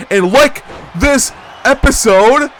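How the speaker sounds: high, loud, stretched announcer yell; hype shout. Acted. Host hyping viewers at the end, loud excited shout. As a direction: sell it like a pro wrestling announcer.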